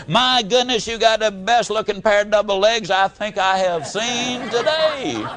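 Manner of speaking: mock tender sweet talk to a dairy cow. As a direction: flatter the old cow lovingly, half playful. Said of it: "You can look at her and say".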